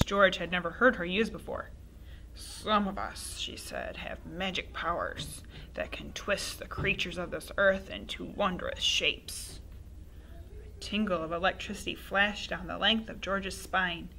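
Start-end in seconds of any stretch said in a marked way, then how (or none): 2.4-9.6 put-on old woman whispering. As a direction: whisper it like a witch. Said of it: "whispering in a throaty sort of voice".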